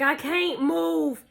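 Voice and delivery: slow mock plea; retold helplessness. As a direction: drag it out, helpless. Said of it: "My mama calling me".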